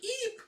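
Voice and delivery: tiny high shriek; startled squeak. Retold or imitated. Girl startled as the blanket is pulled, high squeak. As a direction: squeak in surprise.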